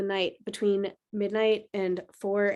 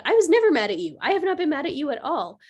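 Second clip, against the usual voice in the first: emphatic insistence; 5 semitones higher, 3 dB louder, faster than usual. Retold or imitated. Mom insisting to upset daughter.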